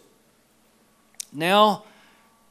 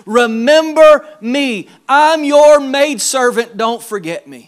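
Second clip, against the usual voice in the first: slow, loud, higher desperate plea; broken plea; 5 semitones higher, 9 dB louder, slower than usual. Acted. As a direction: beg not to be forgotten, slowly. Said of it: "she said these words".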